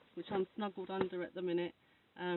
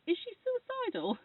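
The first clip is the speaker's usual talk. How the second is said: mock doctor, pitched much higher and louder; retold doctor's question; 14 semitones higher, 5 dB louder than usual. She retells the doctor asking on the phone, high mock voice.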